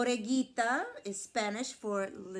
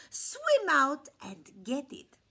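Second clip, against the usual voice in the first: urging lamb; 5 semitones higher, 7 dB louder, slower than usual. A narrator's reading. Lamb urges coyote.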